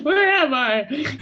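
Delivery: put-on confused old man.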